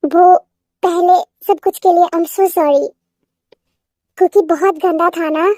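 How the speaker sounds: usual speaking voice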